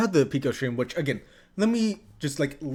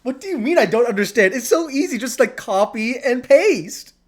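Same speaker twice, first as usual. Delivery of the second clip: raised, louder voice in disbelief; exasperated; 7 semitones higher, 6 dB louder than usual. Real, not acted. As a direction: insist it's easy, pitch riding high. Exasperated at friend failing to link.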